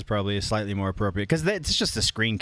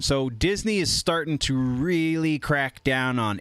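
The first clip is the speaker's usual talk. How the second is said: normal talking voice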